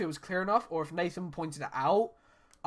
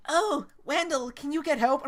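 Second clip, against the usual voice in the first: put-on young Percy voice; 8 semitones higher than usual. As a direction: plead sweetly like a small engine. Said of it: "I hate my voice".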